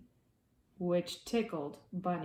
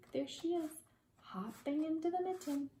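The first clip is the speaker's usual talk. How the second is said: hushed aside; 7 semitones higher, 8 dB quieter, faster than usual. Real, not acted. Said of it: "soft aside pointing at picture".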